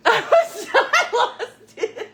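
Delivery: high, loud laughing, slowing down; real laughter. Real, not acted. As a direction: lose it completely. Describes dying laughing.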